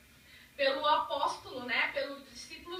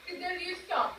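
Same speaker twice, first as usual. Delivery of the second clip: mouth full; 7 dB quieter, slower than usual. Real, not acted. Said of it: "falar de boca cheia".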